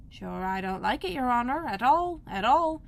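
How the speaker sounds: plain reading voice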